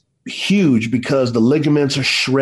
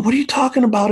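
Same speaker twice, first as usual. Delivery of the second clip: high-pitched puzzled question; baffled disbelief; 10 semitones higher, much faster than usual. Retold or imitated. Dad reacting to injured son's praise, high baffled disbelief. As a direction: replay a dad's bewilderment, pitched well up.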